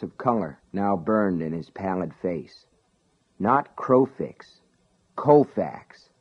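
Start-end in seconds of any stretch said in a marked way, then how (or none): none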